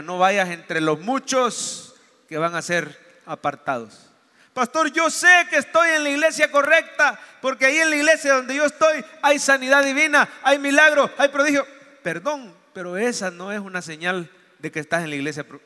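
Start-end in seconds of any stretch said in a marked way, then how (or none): 4.5-11.7 higher and louder, proud churchgoer; a mock enthusiastic claim to the pastor; eager boast. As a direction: play the eager believer bragging.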